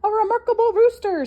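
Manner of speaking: high, loud admiring horse; plow horse voice. Acted. Hector the plow horse admiring Dudley, high and loud. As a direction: praise the rooster way up high and loud. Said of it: "said Hector the plow horse".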